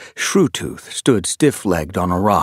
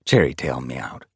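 plain reading voice

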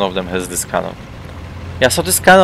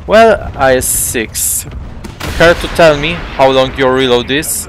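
taunting; 4 semitones higher, 5 dB louder than usual. Real, not acted. Taunting the enemy tank.

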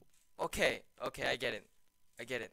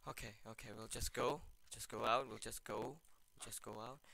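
hushed, nervous self-talk, much softer; frightened hush while sneaking in a horror game; scared murmur; 9 semitones lower, 19 dB quieter than usual. Real, not acted. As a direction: creep forward muttering to yourself.

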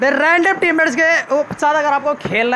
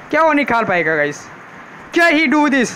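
ordinary talk

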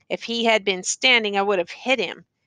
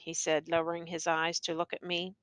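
usual speaking voice

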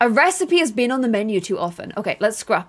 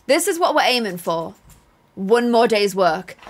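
ordinary talk

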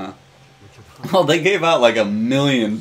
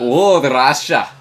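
slow, louder mock Russian accent; bad Russian accent; 5 dB louder, much slower than usual. Co-player reading place name, bad Russian accent. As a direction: draw it out in a hammy Russian accent. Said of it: "really bad Russian accent".

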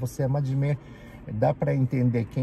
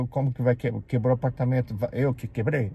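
ordinary talk

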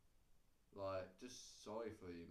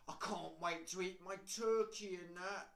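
higher, louder mock excitement; eager anticipation; 7 semitones higher, 6 dB louder than usual. People looking forward to the food, mock-eager. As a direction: put on keen excitement about dinner. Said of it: "people will really look forward to the food".

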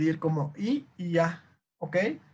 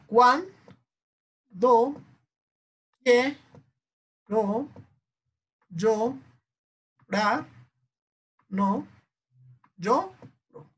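higher, each syllable alone with a pause; sílaba por sílaba; 5 semitones higher, much slower than usual. Shown as a demonstration. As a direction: chant out the syllables slowly, one by one. Teacher splitting next verse into syllables. (sílaba por sílaba, con tono alto)

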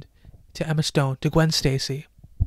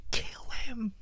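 hushed whisper; 6 semitones higher, 15 dB quieter than usual. Real, not acted. Shocked memory of the stab.

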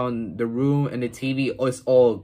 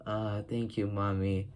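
quiet thanks; 4 semitones lower, 11 dB quieter than usual. Retold or imitated. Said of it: "And he's like".

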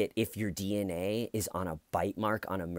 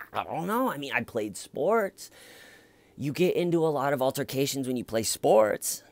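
whiny excuse; 7 semitones higher, 4 dB louder than usual. Retold or imitated. Imitating suspect's excuse.

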